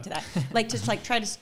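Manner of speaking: ordinary delivery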